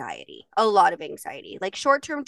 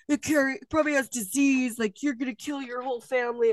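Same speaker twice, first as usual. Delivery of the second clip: higher, whiny mock of the worried husband; mocking Jason; 5 semitones higher than usual. Imitating her husband warning about the bunny in a higher mocking voice. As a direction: parody his germ panic.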